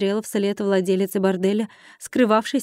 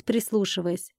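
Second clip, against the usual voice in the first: plain reading voice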